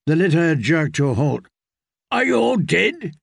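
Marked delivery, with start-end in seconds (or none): 2.1-3.1 much higher, angry roar; roaring knight. Acted. Knight roaring at people blocking the litter. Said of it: "roared Kettleblack".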